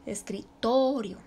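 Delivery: escritorio slowed, with TO stretched out; teacher's slow demo of TO in escritorio; stress on TO. Shown as a demonstration. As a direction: break off escri, then lean long on TO in escritorio. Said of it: "en la sílaba to".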